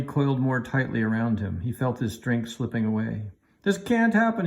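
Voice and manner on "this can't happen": high, loud, gasping cry of alarm; breathless cry. A narrator's reading. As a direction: cry out while the branches squeeze you. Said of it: "he cried, gasping for breath".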